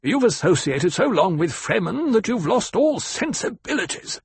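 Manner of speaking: high-pitched, indignant banker; rasped rebuke. Acted. Banker rebukes the ecologist before the table, rasping indignation. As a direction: lash out in offended, high-pitched protest. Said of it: "the banker rasped".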